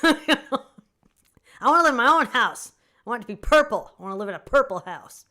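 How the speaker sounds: loud, high laughing gush; giddy. Real, not acted. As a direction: gush about a purple house through laughter.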